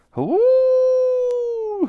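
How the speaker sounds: very high, long "hoo"; mammoth hoot. A narrator's reading. The mammoth joins the celebration, hooting. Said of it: "hooted the Mammoth".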